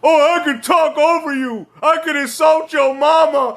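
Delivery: high-pitched mock of a boastful debater; mocking imitation. Mocks the online debaters he scolds.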